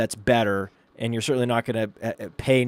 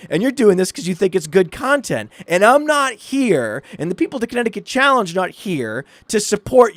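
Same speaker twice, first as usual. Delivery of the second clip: high-pitched, emphatic accusing tirade, imitated; furious wife; 7 semitones higher, 4 dB louder than usual. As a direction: tear into a husband, playing the angry wife. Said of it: "And she was, like, furious".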